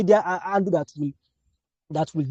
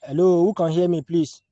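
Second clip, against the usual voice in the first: calling from far away; 3 semitones higher, 4 dB louder than usual. Real, not acted. Checking if online class hears.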